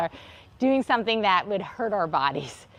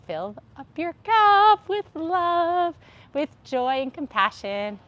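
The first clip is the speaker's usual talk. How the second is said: gentle uplift; 7 semitones higher, 3 dB louder, slower than usual. Acted. Soft uplifting closing.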